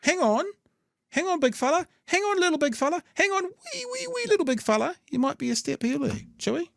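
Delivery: very high, squeaky put-on voice; teasing sing-song falsetto, far above normal; mock placating voice. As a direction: talk down an overeager little one in falsetto. Mock reply to the eager minister.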